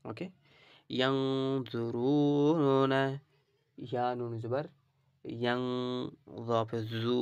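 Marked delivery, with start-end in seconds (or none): 1.0-3.0 ইয়ানজুরুনা with nasal ন, slow; nasal ghunnah demo. Shown as a demonstration. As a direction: hum the ন, then read on. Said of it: "গুণা করবো". (ইয়ানজুরুনা তে গুন্নাহ)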